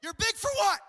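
loud, very high mock outrage; incredulous challenge. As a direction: throw it out high and loud at the big guy. Repeats his challenge to big guys.

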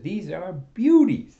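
high, loud, slow and drawn out, admiring; gushing salesman. Acted. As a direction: sell it like a treasure. Eager salesman pitching fishing poles.

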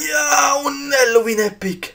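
high, loud joyful shout; excited shout. Real, not acted. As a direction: shout with delight at the drop. Rare loot drop.

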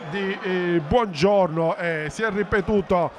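ordinary talk

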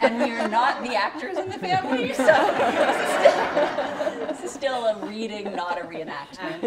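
laughing. Real, not acted. Laughing about not being actors.

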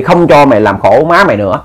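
ordinary delivery, nothing marked

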